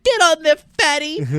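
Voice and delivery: loud, very high falsetto woman; mock rambling wife. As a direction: ramble on in a shrieky put-on wife voice. Wife voice quoting her husband.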